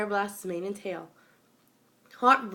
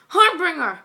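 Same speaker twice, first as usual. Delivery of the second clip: very loud, higher cry of a name; desperate cry; 10 semitones higher, 13 dB louder than usual. Acted. Babsy crying out as her friend is dragged off, very loud. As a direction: scream your friend's name after her.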